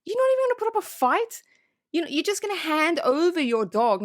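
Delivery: high, fast outraged squeal; mock outrage. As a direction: squeak out disbelief at Dorothy. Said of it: "I'm like".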